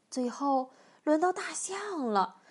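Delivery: plain reading voice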